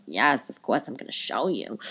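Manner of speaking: low, soft mock-soothing voice; reassuring reply. Host answering a fan's request, low reassurance. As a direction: drop down and humor the asker.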